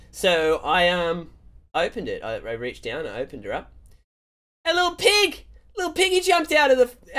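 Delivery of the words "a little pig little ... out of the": high-pitched excited reveal; hammed-up amazement; delighted surprise. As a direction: squeal it like a storyteller's big reveal. Reveals the piglet in story.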